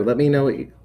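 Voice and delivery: ordinary talk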